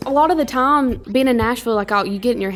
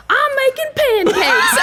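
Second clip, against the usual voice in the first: very high, loud, drawn-out call; retold excited announcement; 6 dB louder, slower than usual. Singer imitating Lainey at the door next morning, high excited holler. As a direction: sing out the news, hammed up. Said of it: "runs the door and she's like".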